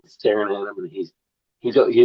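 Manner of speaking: normal talking voice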